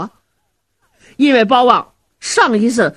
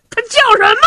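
jumping up with a sharp, high cry of disbelief; startled outburst; 12 semitones higher than usual. Acted. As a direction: hit it high, like you just shot to your feet. Zhao Hu jumps up startled.

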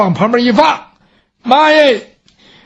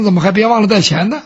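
plain reading voice

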